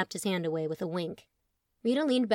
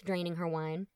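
usual speaking voice

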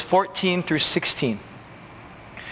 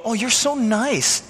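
higher, slow gushing praise; gushing admirer; 6 semitones higher, slower than usual. Retold or imitated. A churchgoer praising a good deed, gushing. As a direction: coo it like an impressed fan. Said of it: "so people go".

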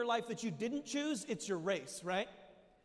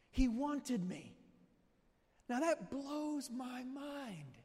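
tearful; 3 semitones higher, much slower than usual. Real, not acted. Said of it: "I'm crying about it too".

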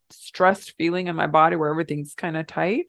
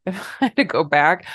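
normal talking voice

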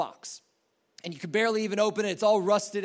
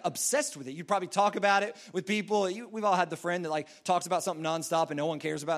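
ordinary talk

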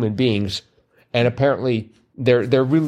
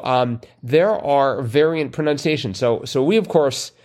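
ordinary delivery

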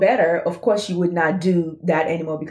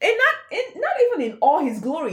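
much higher, exasperated scold; pitched-up disbelieving rebuke; mock scolding. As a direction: scold them in a high incredulous voice. Scolding the Israelites in voice.

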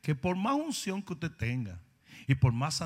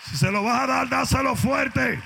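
loud, high preacher shout; rally the church to give it everything; shouting exhortation; 10 semitones higher, 7 dB louder than usual. Acted. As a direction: pastor firing up the church, shouting. Preacher urging congregation, shouting.

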